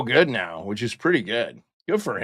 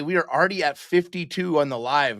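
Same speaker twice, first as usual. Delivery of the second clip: ordinary talk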